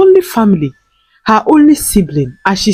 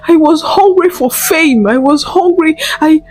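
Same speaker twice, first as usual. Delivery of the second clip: high, fast, pleading confession; tearful confession; 7 semitones higher than usual. A narrator's reading. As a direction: pour out your guilt to mother. Confessing, begging mother's forgiveness.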